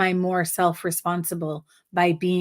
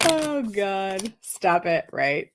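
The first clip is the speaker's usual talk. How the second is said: laughing it off, high and breathy with laughter; laughing at her own reading; giggling; 6 semitones higher, slower than usual. Real, not acted. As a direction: giggle through the words. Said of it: "I'm giggling and laughing".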